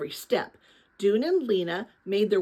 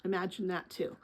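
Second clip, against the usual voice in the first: soft aside; 4 semitones lower, 10 dB quieter than usual. Real, not acted. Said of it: "reader aside to listeners".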